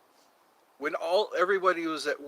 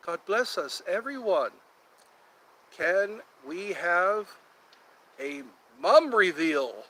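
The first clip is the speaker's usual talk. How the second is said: normal talking voice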